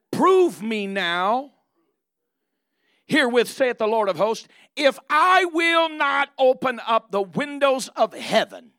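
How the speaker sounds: slow, loud, lifted proclamation; booming voice of God. Acted. Preacher speaking as the Lord to the congregation, booming. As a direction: thunder the promise out slowly.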